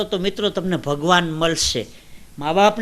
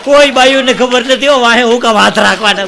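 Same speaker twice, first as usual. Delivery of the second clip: raised, emphatic comic protest; loud exclamation; 5 semitones higher, 9 dB louder than usual. Real, not acted. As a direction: belt it out to the whole hall. Exclaims in mock exasperation to audience. (મોટા અવાજે ભારપૂર્વક)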